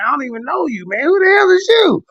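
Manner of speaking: high-pitched baffled challenge; mock incredulity. Talking back to unknown trolls in chat, mock incredulous. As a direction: play up not knowing this person at all. Retelling his reply to strange trolls.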